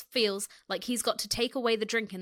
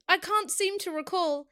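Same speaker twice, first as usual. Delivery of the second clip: high-pitched playful tease; teasing; 7 semitones higher than usual. A narrator's reading. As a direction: feign forgetfulness, teasingly. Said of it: "his tone teasing".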